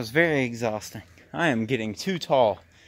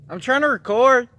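high, loud call at someone far off; annoyed shout; 11 semitones higher, 8 dB louder than usual. Real, not acted. Shouting at whoever disturbs the recording, annoyed. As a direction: shout it out, high-pitched and irked.

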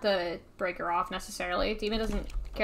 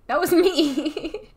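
laughing; 5 semitones higher, 5 dB louder, much slower than usual. Real, not acted. Laughing admission.

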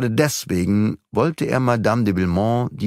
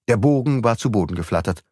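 usual speaking voice

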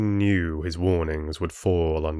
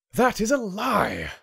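high-pitched furious cry, moderately raised volume; roaring; 13 semitones higher than usual. A narrator's reading. Rin denying the chieftain's accusation, roaring. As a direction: burst out in outraged denial. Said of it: "Rin roared".

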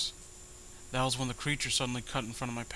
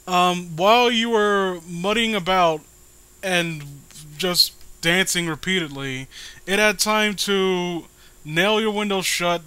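sarcastic mockery; 4 semitones higher, 7 dB louder than usual. Real, not acted. Mocks the story's narrator directly.

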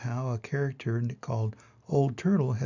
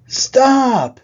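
sudden loud, high storybook shout; commanding shout; 12 semitones higher, 15 dB louder than usual. A narrator's reading. New voice halting the arguing animals, shouted command. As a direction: cut through the noise with one big cry.

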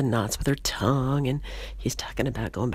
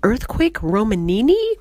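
stammering in shock, higher and louder; 7 semitones higher, 5 dB louder than usual. Acted. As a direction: stutter it out, stunned. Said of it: "and he says".

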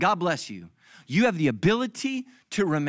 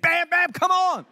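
much higher, slow, hammed-up frantic cry; 12 semitones higher, 4 dB louder, slower than usual. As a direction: yelp it while pounding on the door. I'm punching the flight attendant.

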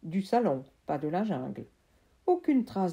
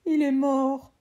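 mild sob; 7 semitones higher, 7 dB louder than usual. Acted. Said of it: "me suis-je mis à sangloter".

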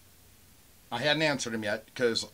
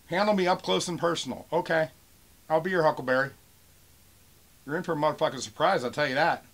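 raised, defiant retort; pissed off; 5 semitones higher, 5 dB louder than usual. Real, not acted. Seller answering a buyer's threat, pissed-off defiance. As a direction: throw the threat back with real irritation. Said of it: "I'm pissed off right now".

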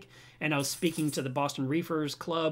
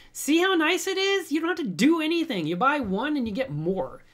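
much higher, louder delight; excited; 11 semitones higher, 6 dB louder than usual. Real, not acted. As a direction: gush with delight at the viewer. Excited reacting to viewer comment.

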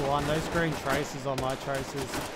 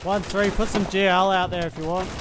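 calling out; 6 semitones higher, 8 dB louder than usual. Real, not acted. Calling order across battlefield.

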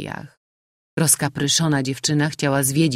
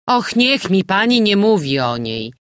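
louder, higher excited landlady; exclaiming; 5 semitones higher, 6 dB louder than usual. A narrator's reading. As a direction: burst out with the news. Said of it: "wykrzyknęła gospodyni".

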